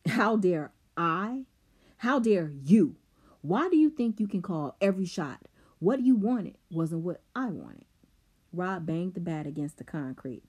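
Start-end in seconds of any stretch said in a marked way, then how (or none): 0.1-7.8 heated. Acted. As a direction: throw his words back at him, hot. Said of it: "his voice heated".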